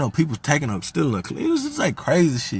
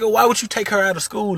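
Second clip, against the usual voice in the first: mad retort; 12 semitones higher than usual. Retold or imitated. Brother on the phone to stepmom, angry and higher. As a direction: confront her with the question. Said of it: "I'm getting mad".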